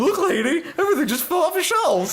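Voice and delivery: hammed-up shopkeeper; high mock shopkeeper. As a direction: complain to the lady in a squeaky voice. Voicing shopkeeper.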